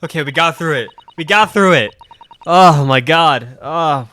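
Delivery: real relieved laughter; relieved shout. Real, not acted. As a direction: crow with relief. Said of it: "My cheeks hurt".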